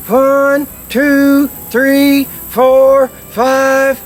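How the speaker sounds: steady long notes on a duck call; duck call honks. Shown as a demonstration. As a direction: blow each count evenly into the call. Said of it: "I'm just blowing into the call".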